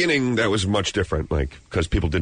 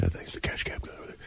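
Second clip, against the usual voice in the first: hushed whisper, much softer than talk; 2 semitones lower, 12 dB quieter than usual. Retold or imitated. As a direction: whisper it like gossip about a celebrity nearby. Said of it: "whispering, like".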